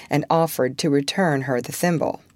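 plain reading voice

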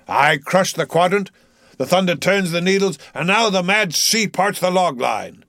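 defiant captain, voice lifted above the narration; commanding Ahab. A narrator's reading. Ahab orders the crew after losing the log, commanding. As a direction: declaim it forcefully, then give orders.

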